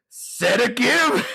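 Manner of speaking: slow, high-pitched mock-angry question; angry, out-of-breath movie line, hammed up; imitating pissed-off outburst. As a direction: draw it out in comic outrage. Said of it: "So fucking pissed off and angry".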